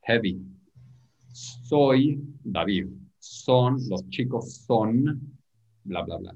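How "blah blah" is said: ordinary delivery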